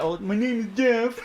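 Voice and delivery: high mock meme voice; goofy kid meme delivery; kids doing the meme. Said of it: "like, say that".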